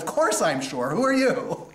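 high, loud American retort; American English. Retold or imitated. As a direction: fire it back in American English. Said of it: "in American English".

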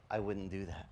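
soft, low, gentle warning; calm quiet voice. Retold or imitated. Preacher voicing the Holy Spirit, soft and low. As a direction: say it softly like a still small voice. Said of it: "the Holy Spirit said".

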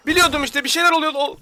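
loud, high panicked outburst. Real, not acted. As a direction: burst out in panic. Korku oyununda panik. (panikle yüksek sesle bağırış)

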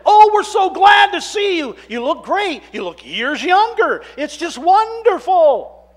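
high, loud, delighted welcome; excited greeting. Retold or imitated. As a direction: gush over an old friend back from death. Friends greeting the risen Lazarus.

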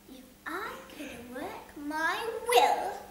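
very slow, deliberate threat; slow, menacing Scrooge. Acted. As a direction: savor the threat.

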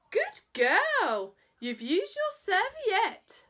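high, loud, slow pleased praise; father's mock-delighted approval; praising father. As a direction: praise a child for good manners.

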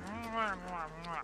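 loud smacking kiss sounds; baby kisses. Pretend. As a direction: blow big hammy kisses. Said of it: "Sorry. Couldn't resist that".

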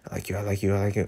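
soft, dreamy repeat; quiet lovestruck echo; swooning murmur. Real, not acted. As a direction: whisper it to yourself, smitten.

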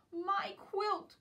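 high, loud alarmed cry. Acted. Quincy calling as the dog grabs his quilt, high loud cry. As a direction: shout for your stolen quilt. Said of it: "cries Quincy".

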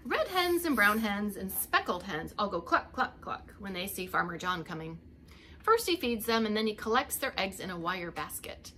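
ordinary delivery

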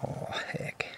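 soft whispered oh heck. Real, not acted. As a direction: breathe it out, disappointed. Whispered disappointment.

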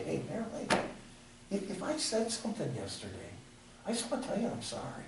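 soft, rushed mock sorry to the wife; quiet imitation of a half-hearted apology; hushed mock apology. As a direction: mumble the sorry as if to slip it by.

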